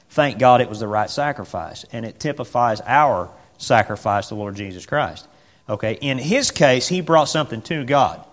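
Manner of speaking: normal talking voice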